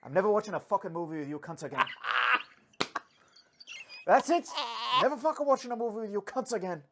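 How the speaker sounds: loud, fast, high indignant Belfast vow; Northern Irish accent. Retold or imitated. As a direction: swear off movies like a fed-up Belfast man. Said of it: "That's a real conversation".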